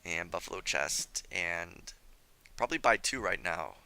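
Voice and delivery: usual speaking voice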